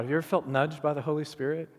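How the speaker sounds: normal talking voice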